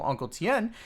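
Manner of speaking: normal talking voice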